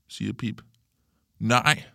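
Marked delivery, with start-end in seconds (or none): 1.4-1.9 loud, higher-pitched refusal; shouted denial. A narrator's reading. Kalle denying he is scared of birds, shouted. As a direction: shout it back at her. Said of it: "råber Kalle".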